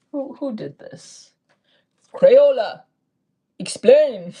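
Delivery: loud, playful call to account; mock demand. Vlogger jokingly calls out Crayola for a sticker flaw, mock demand. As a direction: demand answers in mock outrage, loudly.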